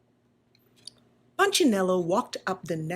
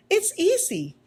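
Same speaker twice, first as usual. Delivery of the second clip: cheerful Lucia; 10 semitones higher, 3 dB louder than usual. Acted. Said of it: "she replied".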